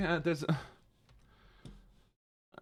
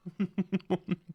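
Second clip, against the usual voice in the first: short rapid giggle; light staccato laugh; 4 semitones higher than usual. Real, not acted. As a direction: let out a quick run of giggles. Own giggle before the panic.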